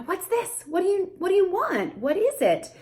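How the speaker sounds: put-on high sing-song questions to a toddler; high bright parentese questions; child-directed questions. Shown as a demonstration. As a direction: ask like you're playing with a toddler. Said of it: "you're probably asking them".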